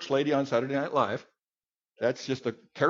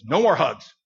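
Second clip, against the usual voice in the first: a louder, curt refusal; father upset with his small son, louder; upset dad; 8 dB louder than usual. Retold or imitated. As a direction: say it like a hurt, angry father. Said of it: "my dad was really mad at me".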